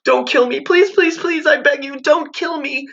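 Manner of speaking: put-on high terrified child begging; desperate begging. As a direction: beg for your life on your knees. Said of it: "begging for mercy".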